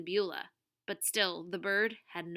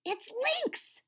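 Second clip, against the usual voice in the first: much higher, relieved exclamation. A narrator's reading. Tuna recognises the airboat, relieved. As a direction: let relief lift the voice high. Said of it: "Tuna said with relief".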